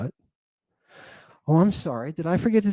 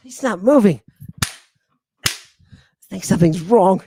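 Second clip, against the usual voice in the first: lightly voiced, higher petulant noble scolding a eunuch; mock royal whine; 6 semitones higher, faster than usual. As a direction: play a spoiled ruler snapping at a servant. Lecturer acts a demanding royal.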